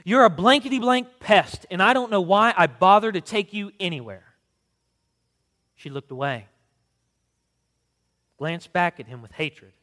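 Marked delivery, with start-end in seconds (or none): 0.0-4.4 loud, high bitter cursing; mother cursing her son in the store, loud. A narrator's reading. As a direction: spit it out at the child. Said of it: "She cursed him".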